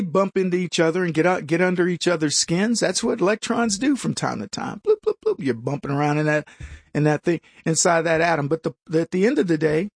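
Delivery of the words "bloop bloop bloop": much higher, bouncy bloops; playful bloop sounds. Pretend. Host imitating electrons bumping, high playful bloops. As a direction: pop the bloops out like bubbles.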